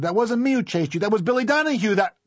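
put-on very high-pitched boy's protest; outraged shout. A narrator's reading. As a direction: yell your denial, then break off. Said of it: "Harry shouted".

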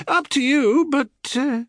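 very high, offhand goddess; feigned indifference, left hanging; airy shrug. Acted. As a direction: toss it away lightly, pitch way up. Selene shrugs off his refusal.

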